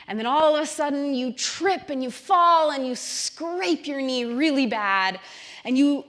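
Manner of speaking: lively guided visualization; guided imagery. Acted. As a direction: paint the scene for the congregation. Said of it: "we're gonna pretend we're little kids".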